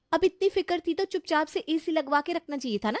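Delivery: high-pitched scolding with a huff; huffy bride. Acted. Bride answers husband who hushed her, huffy. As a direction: retort high, then end on a huff.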